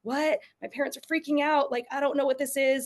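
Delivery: lightly voiced confused child; confused toddler. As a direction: play the baffled toddler. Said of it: "they're like".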